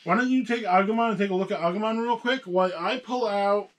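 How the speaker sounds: usual speaking voice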